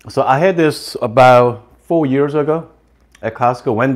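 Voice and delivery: chewing while talking; just took a bite; mouth partly full. Real, not acted. Seen 2: Bites dumpling at -1.0, then talks with fork, mouth working.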